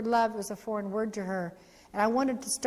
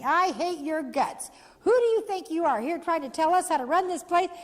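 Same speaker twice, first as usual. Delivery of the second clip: mock furious teen, higher and louder; imitating angry teen; 7 semitones higher, 3 dB louder than usual. Angry girl yelling at the speaker from the back seat. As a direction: hurl it at her like a bitter girl.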